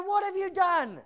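high, loud, frantic demand; fearful shout. Acted. Sailors fearing for their lives question Jonah, panicked shout. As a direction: shout it over wind and waves. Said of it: "They're fearing for their lives".

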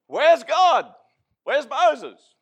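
loud, high demanding shout; crowd calling out. Retold or imitated. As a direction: call out like an impatient crowd. Restless crowd demanding their leader.

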